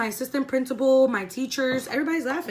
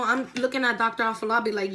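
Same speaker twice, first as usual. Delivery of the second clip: normal talking voice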